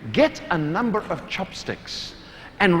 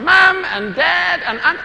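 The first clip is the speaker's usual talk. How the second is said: higher, louder, slow mock call-out; mock shouting name; 10 semitones higher, 4 dB louder, slower than usual. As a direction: imitate a straggler still shouting names. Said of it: "somebody still saying".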